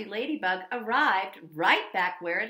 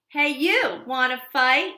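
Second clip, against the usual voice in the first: ladybug voice; 3 semitones higher, 9 dB louder than usual. Acted. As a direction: shout up at the whale. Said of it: "the grouchy ladybug said".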